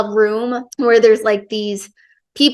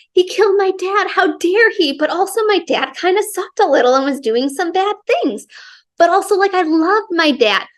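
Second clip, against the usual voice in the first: higher-pitched, dramatized inner argument; torn, conflicted; 8 semitones higher than usual. Acted. Heroine debates herself about her dad's killer, mock-anguished and torn. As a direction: act out being torn, voice pitched up. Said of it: "a torn person's perspective".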